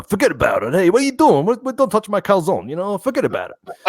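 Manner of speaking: high, hammed-up Brooklyn Italian; Brooklyn accent. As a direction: imitate pushy Brooklyn Italian relatives. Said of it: "I was in Brooklyn".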